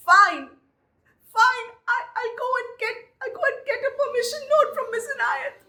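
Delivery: high, choked, defiant through tears; tearful defiance. Retold or imitated. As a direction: hold back tears and stand your ground. Tearful girl defying her teacher.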